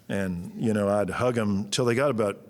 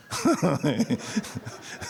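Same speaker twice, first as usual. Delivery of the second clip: short high real laugh; amused laugh, higher; 8 semitones higher than usual. Real, not acted. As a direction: burst out laughing at your own bit. Laughs after the mock scream.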